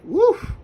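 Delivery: squeaky, loud woof of disgust; sudden high woof of disgust. Real, not acted. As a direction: yelp it out like a bad smell hit you. Reacting to secondhand smoke.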